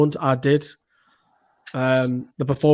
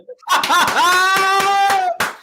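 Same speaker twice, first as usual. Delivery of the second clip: high shouted laugh of joy; shrieking laugh; 3 dB louder than usual. Real, not acted. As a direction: whoop with laughter. Celebrating goal.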